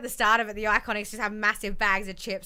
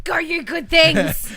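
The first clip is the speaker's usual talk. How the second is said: high, loud, drawn-out holler; rallying cheer; 7 semitones higher, 6 dB louder, much slower than usual. Real, not acted. Co-host cheering on the winning team, rousing shout. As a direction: cheer them on slowly and loudly.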